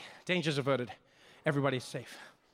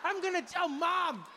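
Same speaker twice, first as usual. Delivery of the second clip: put-on child's voice; 13 semitones higher than usual. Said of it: "this kid was like".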